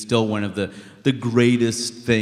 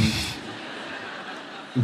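soft breathy laugh; a quick quiet chuckle; laughing under his breath at the acronym; 10 dB quieter than usual. Real, not acted. Laughing at his own joke.

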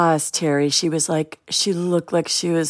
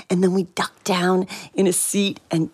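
normal talking voice

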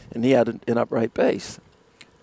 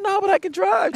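cheeky younger self. Retold or imitated. Cheeky teen asking.

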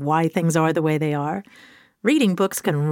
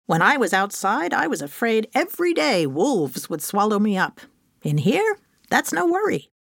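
duck character voice; 3 semitones higher than usual. Acted. Duck answers the mouse.

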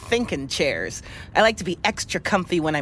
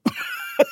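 high burst of laughter. Real, not acted. Laughing at Roblox talk.

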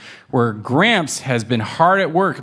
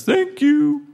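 high pleased thanks. Acted. Gramps thanks kittens.

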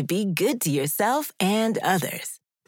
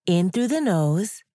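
guided breathing. Acted. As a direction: gently guide the breaths, unhurried. Leads a breathing exercise.

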